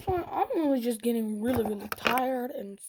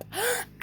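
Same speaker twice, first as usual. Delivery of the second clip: sharp high flinching gasp; startled gasp; 11 semitones higher than usual. Retold or imitated. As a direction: gasp like a punch is coming. Girl flinches at fake punch.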